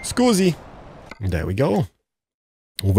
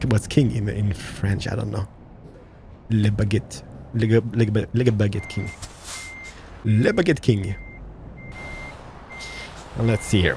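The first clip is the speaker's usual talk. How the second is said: ordinary talk, nothing marked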